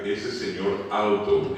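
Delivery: slow, measured "Ese señor alto"; stretched example phrase, "Ese señor alto"; slow dictation. Shown as a demonstration.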